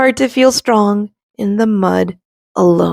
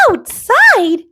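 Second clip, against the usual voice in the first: high startled gasp of a word; alarmed little acorn; shocked acorn; 12 semitones higher than usual. A narrator's reading. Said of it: "says the acorn".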